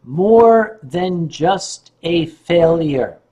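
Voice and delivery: very slow, measured title; slow dictation. Shown as a demonstration. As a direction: spell out the title word by word for note-takers. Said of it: "Write that down".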